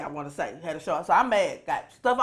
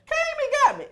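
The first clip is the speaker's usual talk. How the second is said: exasperated; 4 dB louder than usual. Retold or imitated. Retelling being picked up.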